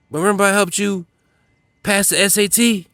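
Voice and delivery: high, pleased-with-himself mock reminder; smug clueless reminder. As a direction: proudly bring up an old favor, voice up high. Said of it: "you look over and say".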